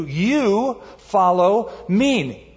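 each word of "You follow me" drawn out, "You" pitched high; emphatic YOU. Acted. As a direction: stretch "You" high, then space out "follow me". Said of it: "emphatic you in Greek".

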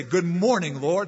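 bright, rising good morning to the Lord; cheerful greeting. Pretend. Believer greeting God each morning, bright and cheerful. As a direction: greet the day gladly, lifting at the end.

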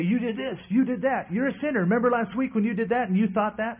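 high, loud taunting accuser; Satan accusing. Acted. Satan taunting a believer about his sins, high and loud. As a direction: jab at him with every sin.

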